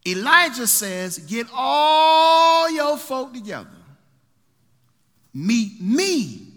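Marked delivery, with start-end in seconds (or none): 1.2-6.5 slow, drawn-out shout, much higher and louder; booming summons. Acted. Elijah shouting to gather the people. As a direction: bellow it out like a prophet calling the nation.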